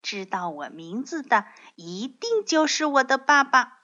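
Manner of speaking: eager, slightly high little one's voice; trusting baby dinosaur. Acted.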